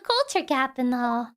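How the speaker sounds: usual speaking voice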